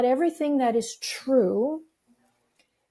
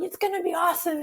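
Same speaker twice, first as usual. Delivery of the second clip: high-pitched upbeat pep talk, imitated; cheerleader encouragement; 7 semitones higher, faster than usual. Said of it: "my cheerleader encouragement".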